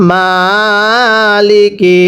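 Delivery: Maliki stretched very slowly; teacher models Maliki slowly; slow drawn-out reading. Shown as a demonstration. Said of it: "আর একবার পড়তে হবে".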